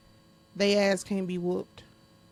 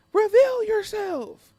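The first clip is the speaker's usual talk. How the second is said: much higher, louder and slow, hammed up; hammed-up demand to reveal yourself; dramatic challenge; 12 semitones higher, 6 dB louder, much slower than usual. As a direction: call out like a theatrical villain hunter. Repeat, dramatic.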